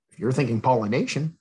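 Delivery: usual speaking voice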